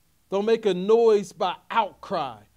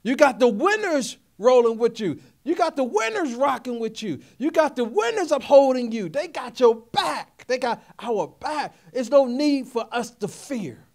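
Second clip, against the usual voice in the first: raised, emphatic sermon voice building in force; impassioned preaching; 6 semitones higher, 5 dB louder than usual. Real, not acted. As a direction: preach it with mounting conviction, pitch climbing. Preaches a rousing refrain.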